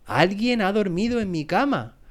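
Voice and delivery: high, mildly outraged papa bear; mock roar. A narrator's reading. Papa bear finding his bed slept in, lightly roared. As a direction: exclaim it high, falling at the end. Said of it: "rugió el oso papá".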